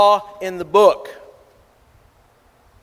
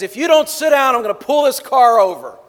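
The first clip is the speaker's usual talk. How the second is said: higher, louder fed-up dad warning; stern threat; 6 semitones higher, 5 dB louder, faster than usual. Retold or imitated. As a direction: warn like a dad at his limit. Dad threatens boy in car.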